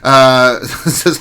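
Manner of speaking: normal talking voice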